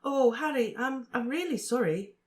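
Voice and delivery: put-on high, halting girl's voice, sorry; apologetic Cho. A narrator's reading. Cho apologising to Harry, high and halting. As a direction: stumble gently through the apology. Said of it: "she looked it too".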